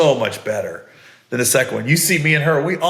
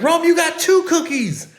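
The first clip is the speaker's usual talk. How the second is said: very high, mock-amazed parent voice; retold cry of delight over a child's luck; excited reaction. Parents react to cookie reveal.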